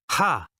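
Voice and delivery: short mocking snort of a laugh. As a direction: scoff out one sharp laugh. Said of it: "Marco snorted".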